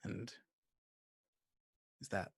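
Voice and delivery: soft, halting suspense narration; game master scene setting. Acted. Game master leading a player toward smoke and blood, hushed suspense. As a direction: set the scene quietly with long pauses.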